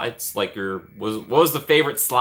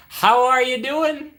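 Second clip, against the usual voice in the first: much higher, slow drawn-out hello; playful high greeting; 12 semitones higher, 3 dB louder, much slower than usual. Real, not acted. Streamer greets a viewer, playfully high and drawn out. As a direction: sing out the question high and stretched.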